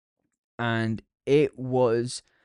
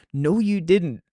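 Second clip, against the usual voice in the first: sarcastic retort; 5 semitones higher, 3 dB louder, slower than usual. Retold or imitated. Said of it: "I'm like".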